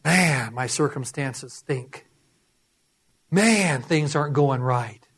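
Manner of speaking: dejected grumble, sinking low; whining complaint. Acted. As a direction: play a downhearted complainer moaning to himself. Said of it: "Whining and complaining".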